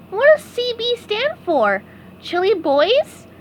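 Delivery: high curious sponge; SpongeBob voice. A narrator's reading. SpongeBob asking about his jersey, high curious voice. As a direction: innocent guess.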